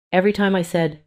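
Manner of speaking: ordinary delivery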